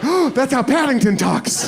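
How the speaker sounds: high, gasped mock discovery; mock amazement. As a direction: react with exaggerated wonder. Comic amazed reaction to the accent.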